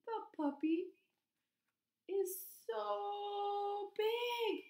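high, hugely stretched SO BIG; wondering emphasis. Shown as a demonstration. Teacher showing children the puppy's size, marvelling.

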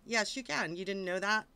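ordinary talk

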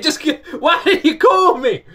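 slow, high, loud, laughing through it; laughing yell. Retold or imitated. Husband asking wife why she didn't call, laughing yell. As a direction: cry it out with relief, breaking into laughter. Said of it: "I was like".

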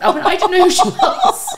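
high, loud laughing protest; laughing hard. Real, not acted. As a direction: squeal it through real laughter. Laughing at own embarrassing story.

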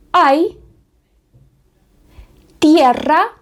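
Spanish tierra; Spanish model word. Shown as a demonstration. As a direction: model т'єрра for learners. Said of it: "спробуємо прочитати слова".

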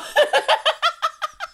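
very high, loud helpless laugh; real squealing laughter; laughing fit. Real, not acted. Storyteller cracking up mid-recording, high helpless laughter. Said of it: "me deu crise agora".

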